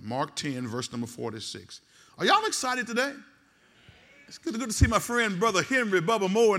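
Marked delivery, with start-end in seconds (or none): 2.2-6.6 preaching at full force. Real, not acted. Preacher greeting the congregation.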